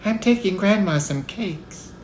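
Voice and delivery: put-on high, timid child's reply; small high-pitched girl, slowing; faint little-girl voice. A narrator's reading. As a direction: answer the wolf in a small, high voice. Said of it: "in a faint voice".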